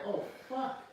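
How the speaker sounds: high, exasperated God voice; God, caught off guard and irritated; annoyed God voice. Acted.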